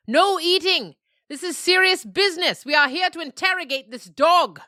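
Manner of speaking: put-on high, loud bossy scold of an old nanny goat; stern goat elder scolding. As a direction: snap the herd back to order, high and loud.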